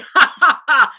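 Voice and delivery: loud, high burst of laughter. Real, not acted. Husband delighting in his bad joke, loud high laugh. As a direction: crack up at your own joke.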